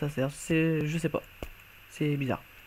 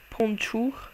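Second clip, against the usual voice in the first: put-on failed English accent; 6 semitones higher, 3 dB quieter than usual. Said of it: "Non, c'est pas l'accent anglais".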